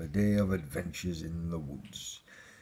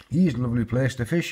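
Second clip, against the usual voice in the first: quick, raised call to the dog; 5 semitones higher, 5 dB louder, faster than usual. A narrator's reading. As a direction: call brightly to your dog, fast and loud. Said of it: "he called to Nip".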